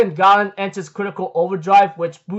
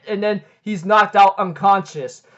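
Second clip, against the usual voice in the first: usual speaking voice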